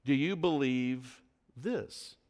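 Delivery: "this" set apart after a pause; stress on this. Shown as a demonstration. Preacher shows the question is precise, stress on "this". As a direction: hold back, then land on "this" alone. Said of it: "his emphasis was on his last word".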